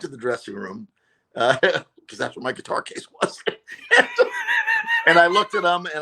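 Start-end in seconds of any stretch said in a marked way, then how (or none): 3.4-5.0 high burst of real laughter. Real, not acted. Telling the host the story, high real laugh. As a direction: laugh out loud at the memory. Recalling the triumph.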